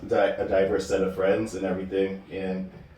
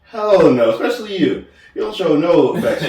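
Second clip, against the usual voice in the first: louder, higher, punching hell no; emphatic loud retake; 7 semitones higher, 7 dB louder than usual. Real, not acted. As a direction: say it again with full force. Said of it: "Let me say it one more time".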